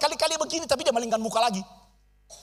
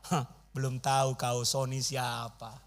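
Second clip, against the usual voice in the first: smug scoff; 7 semitones lower, 5 dB quieter, slower than usual. Retold or imitated. Mocking his proud thoughts.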